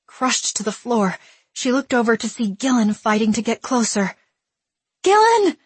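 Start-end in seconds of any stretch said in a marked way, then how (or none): 5.0-5.6 high desperate cry. A narrator's reading. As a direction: call out her name in desperation. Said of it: "Willa cried".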